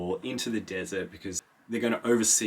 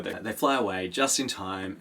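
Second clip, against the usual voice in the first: usual speaking voice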